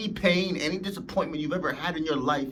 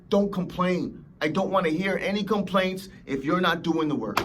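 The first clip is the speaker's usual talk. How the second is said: normal talking voice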